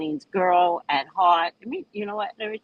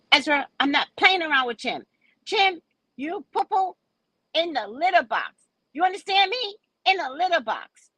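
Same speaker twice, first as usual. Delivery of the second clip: scolding the cat; 11 semitones higher than usual. Acted.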